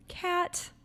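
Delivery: high-pitched playful self-questioning; mock-curious, lilting and high; playful wondering. Shown as a demonstration. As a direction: call your own name high, then wonder lightly. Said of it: "with this degree of playfulness".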